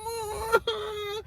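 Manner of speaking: high fake crying wail; mock kid wail. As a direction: imitate the boy bawling, high. Imitating Roger crying.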